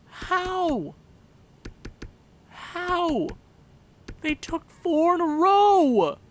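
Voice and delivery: high, loud cries of incredulity; outraged disbelief. Real, not acted. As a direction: yell it at the screen, can't believe it. Disbelief at four goals.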